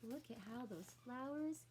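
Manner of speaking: ordinary delivery, nothing marked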